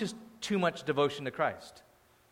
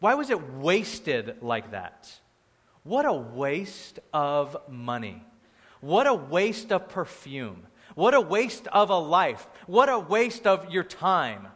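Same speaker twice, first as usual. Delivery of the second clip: slow, drawn-out outrage; mock indignant; 4 dB louder, slower than usual. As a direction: hammer each complaint, stretching the key words.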